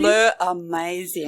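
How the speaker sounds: slow, drawn-out fake French; mock French accent. Guest joking for French listeners, mock French accent. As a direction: stretch it out with a French lilt. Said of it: "my French accent".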